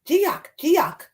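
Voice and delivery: high, excited storybook animal cries; high repeated animal calls. Acted. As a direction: call out like a creature in the trees. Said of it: "another one makes a sound".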